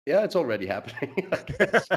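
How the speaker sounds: laughing it off, pitch jumping high. Real, not acted. Guest laughing at the host's wine joke, laughing. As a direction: chuckle through the words as you admit it. Joking about his wine budget.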